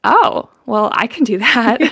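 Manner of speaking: breezy realisation. Retold or imitated. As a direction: act out a sudden confident realisation, loudly. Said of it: "So I went".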